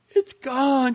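high, loud panicked cry; she's just found the money gone; sister's high panicked wail over lost money; distraught girl. A narrator's reading. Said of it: "there came a terrible shriek".

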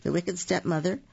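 usual speaking voice

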